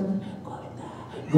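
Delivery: mock timid, very soft voiceless chant; furtive whisper. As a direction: barely breathe it out, imitating someone afraid of being noticed. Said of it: "పక్కవాడు ఎక్కడ చూస్తాడు". (ఎవరికీ వినపడకుండా గుసగుసగా)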